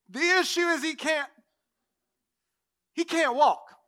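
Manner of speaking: high, insistent mock call; hammed-up, high-pitched protest; friend on the roof. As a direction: explain the obvious from up on the roof. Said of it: "one of his friends sticks his head through the hole".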